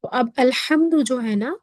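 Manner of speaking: ordinary delivery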